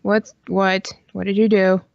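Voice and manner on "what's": usual speaking voice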